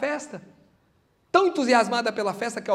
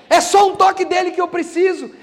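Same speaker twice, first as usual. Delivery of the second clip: higher and louder, pleading; fervent shout; 7 semitones higher, 9 dB louder than usual. Acted. Preacher crying out to the crowd for one touch, shouting. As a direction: shout the need with fervour.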